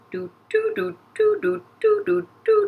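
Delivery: high, bouncy "doo-doo" footsteps; little sung steps in a high voice; tiptoeing doo-doos, pitched high; walking sound effect. Pretend. Voicing the character's walking steps.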